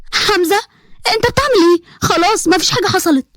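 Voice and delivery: high-pitched, urgent plea to stop; alarmed. Acted. Girl stopping Hamza mid-fight, alarmed.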